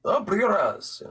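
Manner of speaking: hammed-up Russian mafia accent. Joking to a friend about accents, a mock Russian accent. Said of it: "in Mother Russia".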